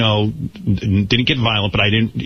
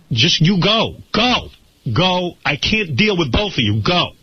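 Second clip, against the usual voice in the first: urgent retold go; 7 semitones higher than usual. Retelling how he urged Beth to go, high urgent pleading. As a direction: push her to leave, voice climbing high. Urging Beth to flee danger.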